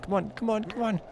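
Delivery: high urging. Real, not acted. Urging sneaking past guard.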